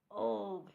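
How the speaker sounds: loud yuck of disgust; disgusted scream. A narrator's reading. Guest biting raw fish, loud disgusted cry. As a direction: spit out a loud ugh. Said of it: "she screamed".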